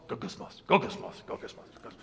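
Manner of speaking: frustrated whispered murmuring; under your breath. Shown as a demonstration. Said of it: "the sound that you make under your voice".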